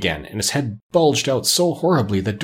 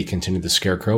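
plain reading voice

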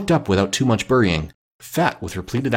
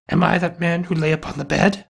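higher-pitched, lightly anguished question; pleading cry; 8 semitones higher than usual. A narrator's reading. Scrooge on his knees before the spirit, pleading cry. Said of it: "he cried upon his knees".

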